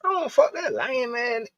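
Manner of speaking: squeaky high, slow mocking girl voice; mock high woman voice. Mimicking the girl's high scolding reply. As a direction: pitch it way up and drag it out.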